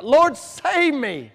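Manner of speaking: drawn-out cry of a sinking man; desperate cry. Retold or imitated. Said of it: "he cries out".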